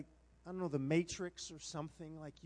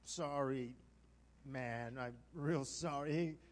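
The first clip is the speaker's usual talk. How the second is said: low, slow sheepish apology; subdued, contrite retelling; humbled apology; 5 semitones lower, much slower than usual. Retold or imitated. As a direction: eat humble pie as you apologize. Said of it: "had a big chunk of humble pie".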